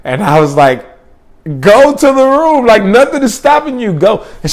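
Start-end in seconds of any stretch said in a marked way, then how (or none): 1.6-4.3 high, raised insistent retort; exasperated. Retold or imitated. Telling the panicking girl to go to the room, exasperated. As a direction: push back loudly, fed up.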